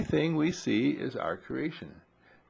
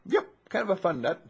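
laughing reply; 6 semitones higher than usual. Retold or imitated. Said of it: "And you say".

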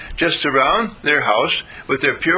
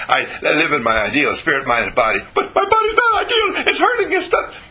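mocking complainer; faster than usual. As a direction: mimic a whiny patient through laughs. Mock complaint, high laughing voice.

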